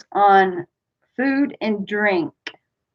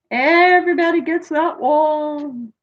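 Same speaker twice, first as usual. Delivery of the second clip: playful singsong; 7 semitones higher than usual. Real, not acted. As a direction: stretch it out high, almost chanting. Playfully repeats it, drawn out and high.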